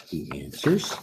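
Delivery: ordinary delivery, nothing marked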